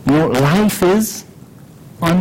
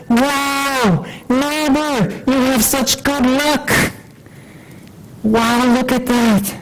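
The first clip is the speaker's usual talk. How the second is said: higher, thrilled neighbor gushing; excited neighbor; 8 semitones higher, slower than usual. Acted. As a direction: gush over the new horses with delight.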